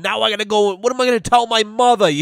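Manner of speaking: high-pitched imitation of a panicking kid; hammed-up panic, pitch shooting up; mock panicked kid. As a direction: wail it high, mocking a hurt boy. Retelling burned kid's panicked reaction.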